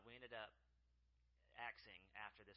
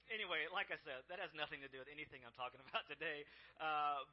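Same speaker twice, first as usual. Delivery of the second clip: ordinary talk, nothing marked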